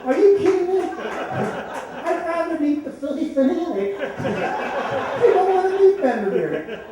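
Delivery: high, laughing disbelief through giggles; laughing mock outrage. Laughing in disbelief at his friend's choice of player. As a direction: crack up while protesting the pick.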